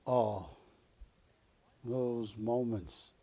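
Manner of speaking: slow, soft and hushed, each phrase left hanging; a dying replicant's last words, savoured; movie death monologue. Retold or imitated. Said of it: "That was a movie".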